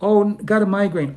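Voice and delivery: high, loud fake-sick excuse; mock excuse. Someone cancelling plans with a migraine, high mock whine. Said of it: "you gotta pass".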